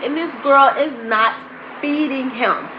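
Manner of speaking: ordinary delivery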